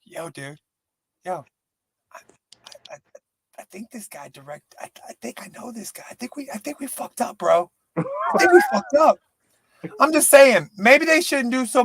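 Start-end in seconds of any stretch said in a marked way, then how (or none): 0.0-7.7 hushed, worried mumbling; nervous cop. Retold or imitated. Cop realizing the mistake, hushed and nervous. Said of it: "the guy's like". 8.0-9.2 squeaky high panicked line; panicked cop. Retold or imitated. Cop realizing the mistake, squeaky panic. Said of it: "the guy's like".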